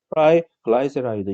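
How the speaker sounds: "트라이글라이세라이드" demonstrated with eye vowels in 트라이 and 글라이; English pronunciation. Shown as a demonstration. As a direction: say "트라이글라이세라이드" the English way, tri and gly rhyming with eye. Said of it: "이렇게 발음을 하거든요". ("트라이글라이세라이드"를 '트라이', '글라이'로 영어식 발음)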